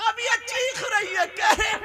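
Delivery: impassioned sermon chant; preaching at full force. Acted. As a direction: wail it out like a fiery preacher over the crowd. Preacher's high chanted climax.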